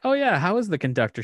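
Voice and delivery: teasing question. Retold or imitated. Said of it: "I'm like".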